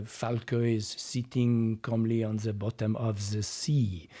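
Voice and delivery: put-on strong French accent; really thick French-accented narration; Jacques Cousteau. Coach imitating a French explorer, really strong French accent. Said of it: "you do have a Jacques Cousteau".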